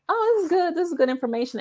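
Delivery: breezy pitch-up, brushing it off; a lightly voiced skimming reader; mock casual reader. Mocking lazy reader.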